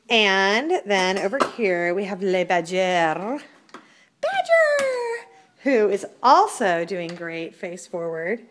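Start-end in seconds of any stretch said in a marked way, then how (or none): 4.2-5.3 high laughing squeal of the name; excited squeal. Real, not acted.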